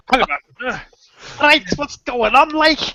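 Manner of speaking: high, loud startled mock Kev; startled Kev impression. Imitating Kev catching the camera, in startled mock alarm.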